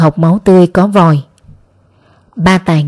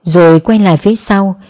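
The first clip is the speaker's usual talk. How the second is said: ordinary delivery, nothing marked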